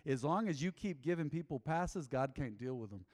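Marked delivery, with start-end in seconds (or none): none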